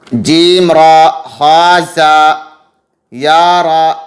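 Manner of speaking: slow letter drill. Shown as a demonstration. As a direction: spell out syllables for children, slowly and clearly. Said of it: "पहचान कराने".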